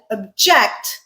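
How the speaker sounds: "object" as ob-JECT, weak first syllable; stress on second syllable. Shown as a demonstration. Teacher shows the verb, "object" stressed on JECT. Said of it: "The way I said it".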